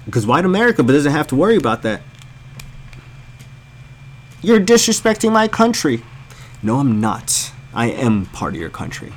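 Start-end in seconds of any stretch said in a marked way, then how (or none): 4.4-6.0 high-pitched whiny imitation of an offended patriot; mock offended patriot. Comic mimicking a critic who says he's disrespecting the country, in a high mock whine. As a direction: squeal it like an insulted flag-waver.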